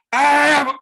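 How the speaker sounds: sudden high, loud scream of terror; terrified scream. Retold or imitated. Friend startled awake in the dark, screaming in terror. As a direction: shriek out as if jolted awake in fear.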